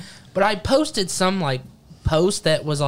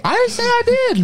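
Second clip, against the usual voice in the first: high, louder defensive protest; 4 dB louder than usual. Real, not acted. Protesting to the scolding friend, high and louder. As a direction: fire back that you never claimed it.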